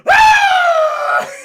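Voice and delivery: very loud, high-pitched mock death scream; comic piercing shriek. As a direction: shriek at full volume. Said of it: "a piercing high scream".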